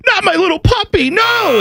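very high-pitched hammed-up dismay; exaggerated worried dog-owner wail; mock distressed viewer. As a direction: squeal in mock panic for a pet. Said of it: "You're like".

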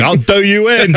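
high mock British threat; English hooligan voice. Mocking football hooligans with a put-on British threat. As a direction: play a cocky English yob picking a fight.

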